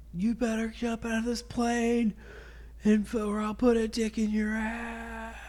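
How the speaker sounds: high-pitched mock sergeant tirade; drill sergeant. Sergeant cursing at the recruit in the plane, high mock rant. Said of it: "So he goes".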